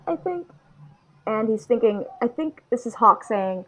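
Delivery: normal talking voice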